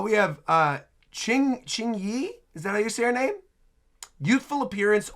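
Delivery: ordinary talk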